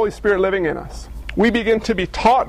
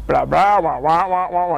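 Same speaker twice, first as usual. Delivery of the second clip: Charlie Brown teacher wah wah; 3 semitones lower than usual. Retold or imitated. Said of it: "the Charlie Brown teacher dictation version".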